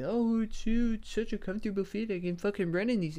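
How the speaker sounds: high, mocking gushing voice; mocking fans. Mocking AirPod fans in a high gushing voice. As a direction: parody people praising AirPods. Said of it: "people make it out to be".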